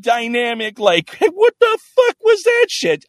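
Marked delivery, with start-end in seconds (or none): 1.1-3.0 high, loud incredulous yell; mock shocked outburst. Reacting to the therapist's gold star offer, as a loud mock outburst. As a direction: fire it off in hammed-up disbelief.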